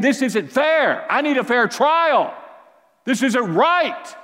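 high and loud, slow indignant complaint; lashing out. Retold or imitated. Preacher imagining himself on the cross, loud and high protest. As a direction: rail at the unfairness like a man wronged. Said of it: "lashing out at people".

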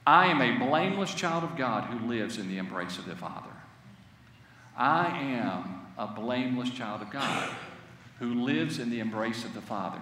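soft, low spoken creed; quiet confession. Shown as a demonstration. Pastor modelling the confession softly for the congregation. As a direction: speak it softly like a private prayer. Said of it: "Say that in your mind".

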